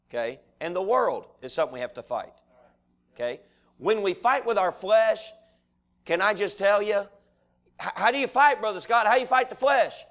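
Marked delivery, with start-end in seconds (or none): 3.8-10.0 high, loud rhetorical questioning; impassioned preaching. Real, not acted. Preacher asks how we fight the flesh, impassioned. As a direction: build up to the question from the pulpit.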